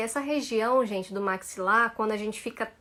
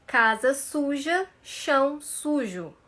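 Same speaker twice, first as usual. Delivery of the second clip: slow, open-mouthed syllables; slow again; 2 semitones higher, slower than usual. Shown as a demonstration. Teacher repeating the drill slowly for the class. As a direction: drag each word out carefully. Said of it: "Mais uma vez".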